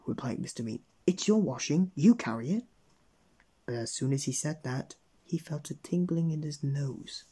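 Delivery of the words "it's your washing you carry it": raised, louder retort; rude refusal. A narrator's reading. Mister Mean refuses the old washerwoman, rudely. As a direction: throw the job straight back.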